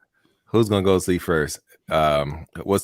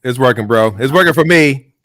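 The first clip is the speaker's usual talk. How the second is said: loud sharp voice; 4 semitones higher, 9 dB louder than usual. Real, not acted. Jokingly backing the fake energy.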